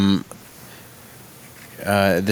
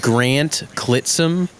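name drawn out very slowly; 4 semitones higher, much slower than usual. Shown as a demonstration. Offering a funny hockey name to friends, very slowly. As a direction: say each word slowly and deliberately. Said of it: "You want me to say it slowly?".

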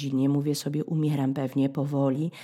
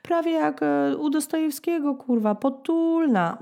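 meek voice; 9 semitones higher than usual. Retold or imitated. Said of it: "Dostoevsky meek".

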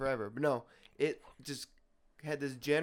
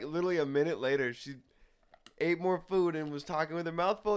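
ordinary delivery

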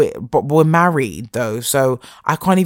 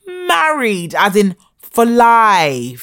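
life stretched out slow; stressed for life; 6 semitones higher, 4 dB louder, much slower than usual. Real, not acted. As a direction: lean hard on for life.

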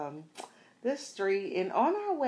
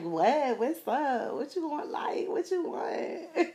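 high, defiant challenge; teen attitude; 8 semitones higher than usual. Retold or imitated. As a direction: snap back like a tough teenager. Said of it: "you like".

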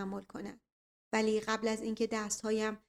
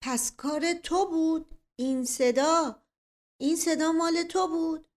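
slow, high angry shout; 7 semitones higher, 6 dB louder than usual. Acted. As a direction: accuse him at full voice. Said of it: "فریاد زد".

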